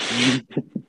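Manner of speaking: short amused laugh before answering. Real, not acted. Guest laughing at host's question, short breathy laugh. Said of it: "I'm laughing not because".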